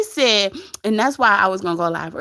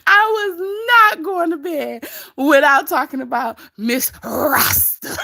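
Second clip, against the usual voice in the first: laughing; 7 semitones higher than usual. Real, not acted. Cracking up about Open Book.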